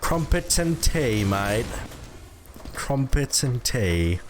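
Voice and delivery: slow, drawn-out mock British accent; bad British accent. Streamer standing by his attempt, slow hammed-up British accent. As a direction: stretch it out in a terrible British accent. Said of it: "Worst British accent I've ever heard".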